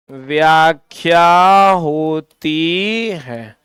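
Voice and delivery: very slow, drawn-out dictation. Shown as a demonstration. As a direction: stretch each phrase out for note-takers, pausing between phrases. Dictating for students to write. (धीरे-धीरे, खींचकर लिखवाते हुए)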